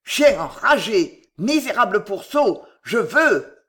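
slow, loud furious outburst; foaming rage. A narrator's reading. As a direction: spit the insults out in fury. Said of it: "il écuma".

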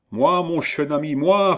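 put-on high-pitched thick German accent in French. A narrator's reading. Hermann calls Ascanio through the door, heavy German accent. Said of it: "avec un accent tudesque des plus prononcés".

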